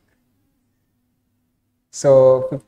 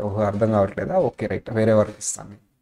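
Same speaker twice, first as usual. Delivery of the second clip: softer, lower, calm and easy; smooth patient reply; 4 semitones lower, 6 dB quieter than usual. Shown as a demonstration. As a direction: gently reassure the trainee. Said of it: "స్మూత్గా చెప్పాలి". (ఓపికగా, మెల్లగా చెప్పడం)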